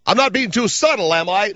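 high cartoonish mock voice; Comb Over Boy voice. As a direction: ask it in the silly high voice. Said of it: "a voice like it's completely out of a cartoon".

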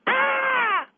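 high, loud mock shriek; the shrill way people hear it. Shown as a demonstration. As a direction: shriek like a startled lady. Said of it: "here's the way people are hearing it".

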